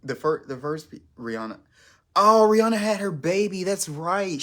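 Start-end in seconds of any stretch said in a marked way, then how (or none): 2.1-4.4 excited. Real, not acted. Sudden remembered celebrity news.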